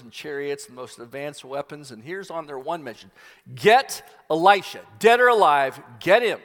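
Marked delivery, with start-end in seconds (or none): 3.6-6.4 loud, driving command; commanding order. Retold or imitated. King sends army to capture Elisha, loud command. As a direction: send the army out with a shout. King's army sent on mission.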